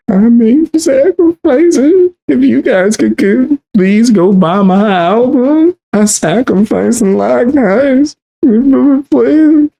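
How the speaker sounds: high, slow, hammed-up sobbing whine; mock crying plea. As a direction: beg for album sales like a crybaby, way over the top. Mocking the crying musician.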